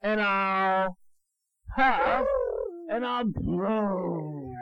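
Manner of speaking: loud, slow, huffing big bad wolf; blustering wolf threat. Father reading to his child, booming the wolf's huff and puff. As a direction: puff each word out like a bully.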